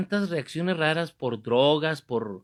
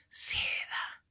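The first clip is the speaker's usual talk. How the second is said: very soft, breath-only whisper; acted confiding whisper; 8 dB quieter than usual. Man repeating his secret to the priest, acted whisper. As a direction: whisper the secret so nobody overhears.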